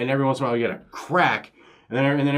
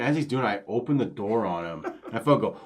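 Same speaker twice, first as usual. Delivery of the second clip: ordinary delivery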